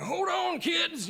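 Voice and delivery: high warning shout; mom's warning yell. Retold or imitated. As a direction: brace the kids, calling out high. Mom yelling while steering.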